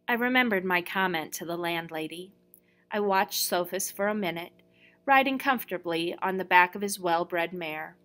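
ordinary delivery

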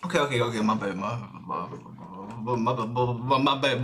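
low, nervous stammering; flustered, repeating syllables in a low voice; nervous stutter. Real, not acted. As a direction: get stuck on the word and stutter through it. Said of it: "I get nervous and I start stuttering".

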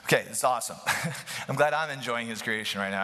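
usual speaking voice

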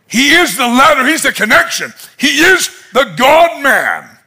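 high, punched declarations; emphatic preaching. Real, not acted. Preacher declaring who Jesus is, proclaiming. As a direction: drive each title home.

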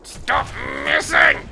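high, loud frustrated cry; frustrated yell. Real, not acted. Frustrated yelling at game.

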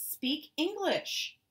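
loud, high, slow demand. Acted. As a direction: raise your voice at her. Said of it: "raising my voice".

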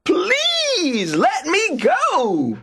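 very high, long-drawn rabbit wail; shouted plea. A narrator's reading. Trapped rabbit begs the tar baby, high drawn-out shout. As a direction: stretch the plea out in a high cry. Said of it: "shouted Brer Rabbit".

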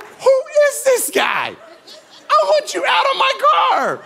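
inner scream to get him out; comic alarmed shout; panicked. Retold or imitated. Panicked inner thought retold.

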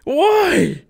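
loud, high shrieks of alarm; real jump-scare outburst; panicked yelling. Real, not acted. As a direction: cry out in shock, pitch leaping.

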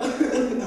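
high giggle; laughing at faked crying. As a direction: burst out laughing. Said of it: "je fais semblant".

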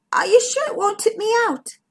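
high-pitched, worried question. A narrator's reading. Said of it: "Bertie worried".

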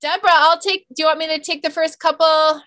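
usual speaking voice

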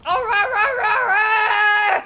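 high, loud repeated "rah" cry; playful loud yowling. Pretend. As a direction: yowl rapid nonsense, then crack up. Playful roaring noise at enemy.

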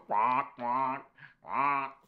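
short playful quacking; duck quacks. Pretend. Reader showing children the yellow duck, playful quacks. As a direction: quack like a duck for little kids.

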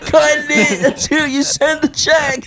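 high, slow joking demand; mock demand. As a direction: drag it out as a mock ultimatum.

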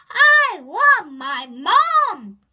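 loud, slow, swooping wail; roar. A narrator's reading. Little princess bored of games, long loud roar. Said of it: "roared the little princess".

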